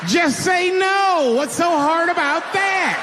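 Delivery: high, slow, full-volume yell; mock exasperated shout. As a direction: holler the advice as if it were obvious. Mock advice yelled at addicts.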